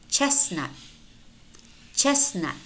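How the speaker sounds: chestnut as "chess-nut"; silent T. Shown as a demonstration. Teacher models silent letters for learners, silent T in chestnut. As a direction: drop the middle T in chestnut. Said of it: "but it's not pronounced".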